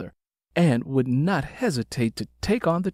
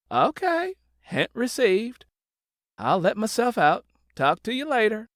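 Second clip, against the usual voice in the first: high, light call from the doorway; shouting back; 10 semitones higher than usual. Acted. Neighbor calling a teasing goodbye as she leaves, high call. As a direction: toss it back over her shoulder, playful. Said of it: "Miss Benita shouted back".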